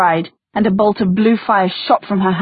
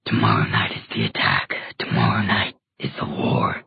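deep, distorted demon voice; 6 dB quieter than usual. Acted. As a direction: growl it from deep inside the beast. Demon speaks from its cage.

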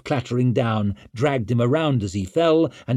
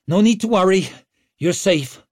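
loud, higher-pitched reassurance; raised voice; 8 semitones higher, 6 dB louder than usual. A narrator's reading. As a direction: call it out to the man just rescued. Rescuer addresses the man she saved.

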